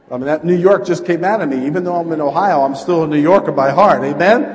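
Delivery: very loud, high exuberant declaration; fired-up shouting. Real, not acted. Preacher claiming New York roots, fired-up shouting. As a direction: holler it proudly to the crowd.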